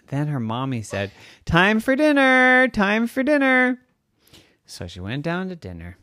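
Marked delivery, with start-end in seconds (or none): 1.4-3.8 high, loud sing-song calling; mom calling out. Acted. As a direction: call the kids down to dinner. Mom calls upstairs.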